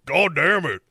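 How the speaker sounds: put-on lighter gruff old-man curse; Wilford Brimley impression. Brimley jumping on a track, mock old-man curse. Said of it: "He comes in to just jump on people's tracks".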